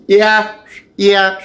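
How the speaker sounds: high, abrupt repeated blurt; sudden high tic words; vocal tic. Real, not acted. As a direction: chirp each word out involuntarily. Involuntary verbal tic.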